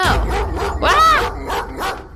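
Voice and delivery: high sharp shriek of fright; startled shriek. Real, not acted. Streamer scared by a dog in her game, shrieking. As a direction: yelp as the dog jumps out.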